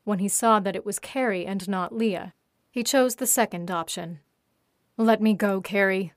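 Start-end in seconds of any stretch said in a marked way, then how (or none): none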